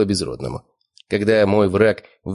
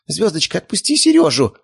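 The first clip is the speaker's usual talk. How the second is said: high alarmed cry, rising in force; frantic high-pitched order; woman screaming; 10 semitones higher, 3 dB louder than usual. A narrator's reading. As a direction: scream at the dog in a high lady's voice. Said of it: "заорала женщина".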